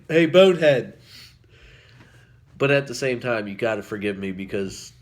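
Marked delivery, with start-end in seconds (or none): 0.1-1.0 mock taunt. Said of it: "you can laugh 'cause it's like".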